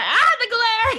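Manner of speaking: high, loud, drawn-out comic wail; play-acted yell of being blinded; mock blinded cry. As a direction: cry out as if dazzled, hammed-up. Acting out being blinded by reflection.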